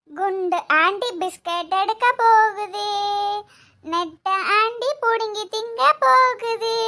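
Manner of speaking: put-on slow, singsong kid teasing; five-year-old child. Child teasing aunties about biscuits, singsong. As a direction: chant it out like a small child.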